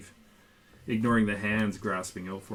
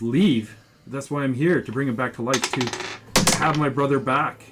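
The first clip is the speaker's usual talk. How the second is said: loud, raised, pleading outcry; outraged protest; 5 semitones higher, 11 dB louder than usual. Acted. Brother refusing to leave the cleric's cave, outraged. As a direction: burst out in disbelief, pushing louder.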